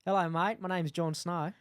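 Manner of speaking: put-on Australian accent. Shown as a demonstration. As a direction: do a broad mock Australian accent. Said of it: "Do it in a Australian".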